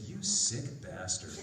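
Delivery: low, slow mock disgust; amused appalled director. Director reacts to the audition, low mock disgust.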